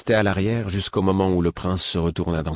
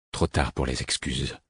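low, softer, weighty voice; grave tone; 6 semitones lower, 6 dB quieter than usual. A narrator's reading. Mentor answering the prince's apology, grave. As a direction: say it gravely, a mock rebuke before forgiving. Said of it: "Dis-je d'un ton grave".

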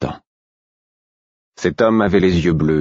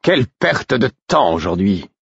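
higher-pitched petulant complaint; disappointed, sulky; 6 semitones higher than usual. A narrator's reading. Royal lamenting the wasted day, disappointed and sulky. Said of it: "déçu et boudeur".

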